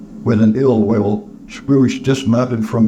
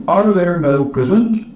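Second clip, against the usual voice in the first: higher-pitched, disagreeable query; disagreeable Scrooge; 8 semitones higher than usual. A narrator's reading. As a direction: ask it as a disagreeable challenge. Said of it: "A disagreeable Scrooge said".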